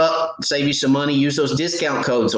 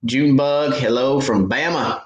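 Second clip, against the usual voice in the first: ordinary delivery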